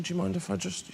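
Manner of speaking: very soft timid asking; barely voiced polite asking; hushed waiter voice.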